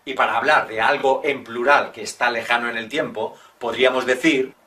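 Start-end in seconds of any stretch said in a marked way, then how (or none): none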